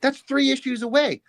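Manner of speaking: high-pitched retold pep talk; mock-exasperated reassurance to yourself; insistent self-talk. Retold telling himself off.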